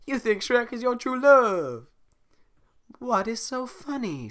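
high, loud, slow indignant princess. Acted. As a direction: demand an answer, offended. Voicing Fiona.

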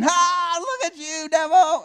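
gleeful angel laughing at Satan; mocking angel laugh. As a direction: jeer at the fallen devil. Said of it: "every angel in heaven starts laughing".

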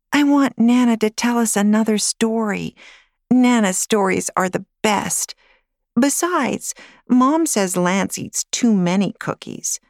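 put-on a six-year-old begging for a story; eager little girl. Girl begs grandmother for a story.

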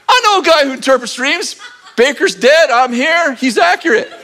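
excited cupbearer. Pretend. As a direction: blurt it out, thrilled to help. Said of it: "the cupbearer remembers".

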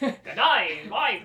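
loud hammed-up Aussie greeting; Australian accent. Shown as a demonstration. Joking with cohost, exaggerated Australian accent. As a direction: lay the Australian on thick. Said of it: "with an Australian accent".

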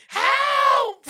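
very high scream for help; retold scream. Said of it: "I'm fucking screaming at the top of my lung".

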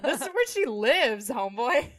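loud, high-pitched mock retort; incredulous retort. Real, not acted. Podcaster answers Chucky's rude question, loudly incredulous. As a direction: exclaim it as if it's obvious.

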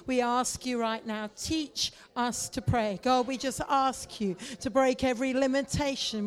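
ordinary talk

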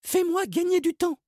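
put-on urgent high girl; Margot's voice. A narrator's reading. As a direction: hurry him along. Margot asks Salim.

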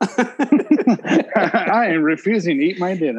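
drawn-out declaration with a laugh in it; laughing mock refusal. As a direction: imitate a defiant kid while cracking up. Acting out the child's refusal, laughing.

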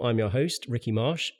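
ordinary delivery

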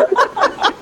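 sudden high giggle; high laugh. Real, not acted. Laughs at own hiccups.

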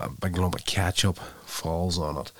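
usual speaking voice

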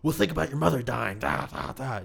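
high, loud, fast hammed-up coach voice; hammed-up coach digging for trauma; mock acting coach. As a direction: ham up a pushy drama teacher. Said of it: "like, dredging up".

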